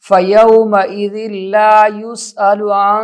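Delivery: slow tajweed with held nasal hum; Quran recitation. Shown as a demonstration. Teacher reciting a verse to learners, slow recitation. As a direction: stretch the long vowels and hum the m.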